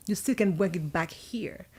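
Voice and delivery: ordinary talk, nothing marked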